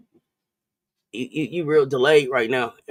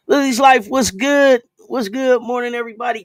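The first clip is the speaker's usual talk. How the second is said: excited greeting; 8 semitones higher, 6 dB louder than usual. Real, not acted. Greeting a viewer in chat.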